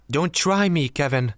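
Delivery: high, loud angry snap; angry warning. A narrator's reading. Zara warning Kevin who forgot the trip, loud angry snap. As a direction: warn him off, anger boiling over. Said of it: "her anger was rising".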